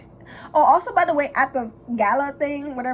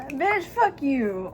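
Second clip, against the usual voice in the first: slow, high-pitched comeback; drawn-out sassy retort; mock retort; 5 semitones higher, much slower than usual. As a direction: drag it out in mock outrage. Her imagined retort to Thea.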